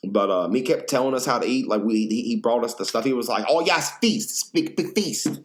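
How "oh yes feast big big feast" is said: higher, slow mock owner announcing; lightly voiced proud owner; imitating the owner. Owner bringing food.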